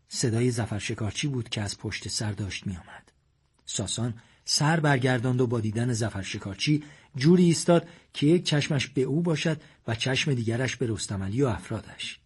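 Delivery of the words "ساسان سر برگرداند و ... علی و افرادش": ordinary delivery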